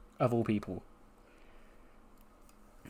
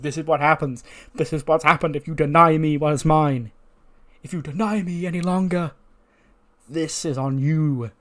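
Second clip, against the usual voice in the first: higher, louder enraged rant; acted scream, restrained but forceful; mock screaming; 5 semitones higher, 5 dB louder than usual. Seth held back after attacking Bryan, acted screaming rant. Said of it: "he's screaming, he goes".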